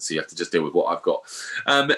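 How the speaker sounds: ordinary talk, nothing marked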